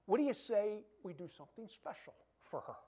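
ordinary talk

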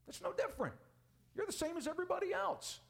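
high, mocking voice of the world; sing-song mocking high voice. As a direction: pitch it up to mock them. Preacher voicing the world's view.

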